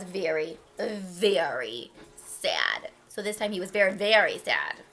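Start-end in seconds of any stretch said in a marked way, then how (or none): 0.0-2.9 slow, low, sorrowful storytelling; a touch of sadness, very slow; drawn-out sadness. A narrator's reading. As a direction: draw it out mournfully. Narrates the train's sadness.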